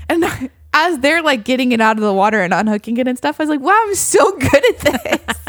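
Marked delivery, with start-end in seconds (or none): 3.6-5.4 mock boast through laughter, slow and high; giggly self-praise over a giant fish; laughing brag. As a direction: brag while cracking up. Bragging about caught fish.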